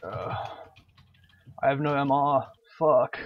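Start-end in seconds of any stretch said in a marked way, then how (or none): 1.6-3.2 loud annoyed burst; frustrated cursing. Real, not acted. Gamer out of resources, swearing loudly. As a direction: spit out the curse in frustration.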